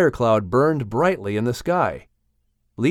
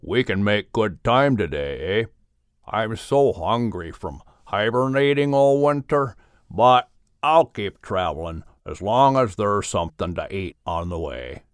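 a big friendly bear talking low and easy; lower, hearty bear voice, jollying his friend along; bear Mel, lower voice; 4 semitones lower than usual. Acted. The bear Mel cheering up grumbling Murray, lower bear voice.

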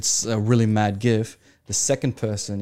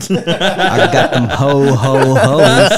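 laughing ho ho ho; 3 semitones higher, 8 dB louder, much slower than usual. Pretend. Joking Santa ho ho ho.

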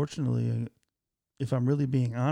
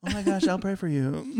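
mock sympathy; 5 semitones higher than usual. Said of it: "mock sympathetic texter".

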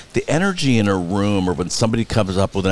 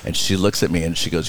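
ordinary talk

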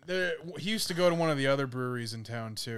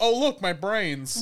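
slow casual mock; 8 semitones higher, 10 dB louder, slower than usual. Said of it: "just goes".

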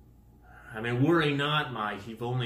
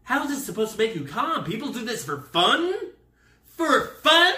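high, rapid rant building to a yell; hammed-up appalled questioning; incredulous outburst; 9 semitones higher, 7 dB louder than usual. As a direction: climb to a loud, high-pitched disbelief. Acting out incredulous panic.

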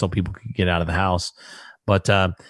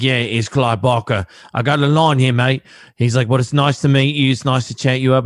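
put-on a light stab at a thick British accent, a bit higher; imitating Clive Barker's British accent, lightly; mock British accent; 3 semitones higher, 3 dB louder than usual. Friend retells Clive Barker calling him, light British accent. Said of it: "that thick British nasty accent that he has".

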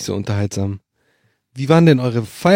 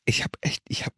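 whispering; 11 dB quieter than usual. Real, not acted. Suddenly whispered aside.

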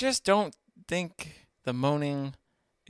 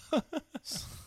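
short high giggle; high laugh; 14 semitones higher than usual. Real, not acted. Laughs at the moan demo.

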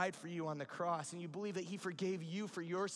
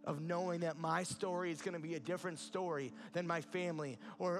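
ordinary talk

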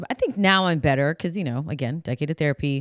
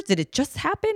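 concerned parent; 10 semitones higher than usual. Retold or imitated. Parent voiced to crying child.

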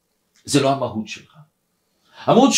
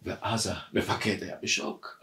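normal talking voice

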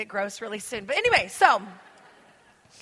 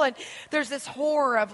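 ordinary talk, nothing marked

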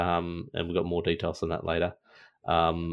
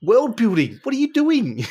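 much higher, louder disbelieving cry; exasperated mock outcry; 7 dB louder, slower than usual. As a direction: throw up your hands at the writers, hammed-up. Mocking exasperated reaction to the game.

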